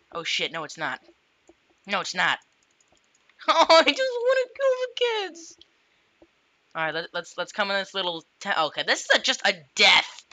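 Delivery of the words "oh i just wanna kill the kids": squeaky high-pitched villain. Pretend. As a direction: squeal it like a cartoon baddie. Silly villain line in game.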